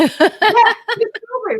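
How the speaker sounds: laughing, high and slow. Real, not acted. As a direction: laugh through the words. Laughing at strawberry joke.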